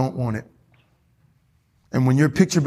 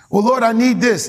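mock pleading believer; 7 semitones higher, 5 dB louder than usual.

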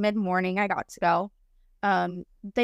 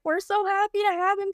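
bright, much higher gushing nurse; cheery nurse voice; 10 semitones higher than usual. Retold or imitated. NICU nurses greeting the mom about her baby, high happy gush. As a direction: sing it out high like a delighted nurse. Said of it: "were like".

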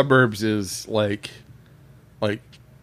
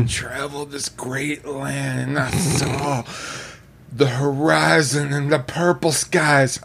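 raspy [name] voice; 2 semitones higher, slower than usual. Pretend. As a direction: rasp it out like [name]. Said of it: "your raspy [name] voice".

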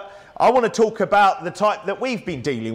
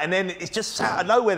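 ordinary talk, nothing marked

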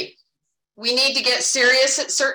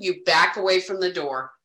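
usual speaking voice

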